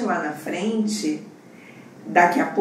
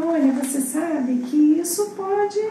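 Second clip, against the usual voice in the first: sugary mock scolding; 10 semitones higher than usual. Imitating the sweet R2 scolding her blunder, sugary mock scolding. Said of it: "Ela te dá o esporro da mesma forma".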